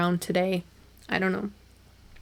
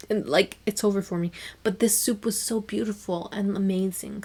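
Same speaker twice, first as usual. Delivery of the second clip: normal talking voice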